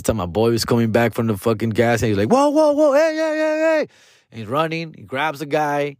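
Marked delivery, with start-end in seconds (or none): 2.3-3.9 high-pitched, held-out calls to stop; urgent intervening shout. Retold or imitated. Friend running in to break up a fight, urgent high calls. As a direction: imitate a friend rushing in to break things up.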